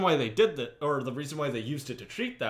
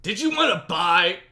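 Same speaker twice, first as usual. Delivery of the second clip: louder, higher salesman pitch; infomercial voice; 4 semitones higher, 7 dB louder than usual. Pretend. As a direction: hype it up like a TV ad. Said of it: "Oh, yeah, that's it".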